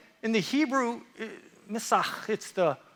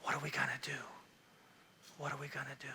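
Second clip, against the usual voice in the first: low, barely audible whisper; hushed dismay; 8 semitones lower, 19 dB quieter, much faster than usual. Acted. Preacher voicing a viewer with no hope left, hushed dismay. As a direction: murmur it as if all is lost.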